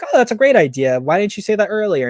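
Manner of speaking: high, hammed-up gushing; mother seizing the gambling idea, high mock enthusiasm; mock fake delight. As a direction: play the mother's phony eager cheer. Mother fakes delight to son.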